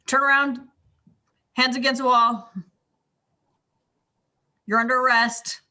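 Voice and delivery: lightly voiced sheriff giving orders. Player acting out a sheriff making an arrest, lightly authoritative. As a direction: issue the arrest in short, spaced commands, a touch firm. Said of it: "do the sheriff thing".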